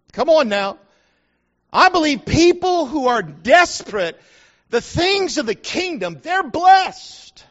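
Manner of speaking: rising preacher's shout to the crowd; preacher shouting blessing to the congregation; fiery preaching. Acted. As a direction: build it up and proclaim. Preaching at full force.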